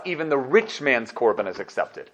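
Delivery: normal talking voice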